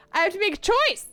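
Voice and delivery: high, loud and overwhelmed; mock-panicked shout. Streamer facing a romance choice, high panicked shout. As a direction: wail the dilemma in mock alarm.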